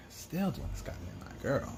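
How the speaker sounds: annoyed whisper; whispered grumble. Real, not acted. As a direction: grumble it under your breath. Annoyed aside while doing makeup.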